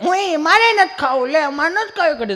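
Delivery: put-on high whiny child refusing food; whiny fussy child. Child whining to grandma that he won't eat kadhi. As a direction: whine like a spoilt kid who won't eat.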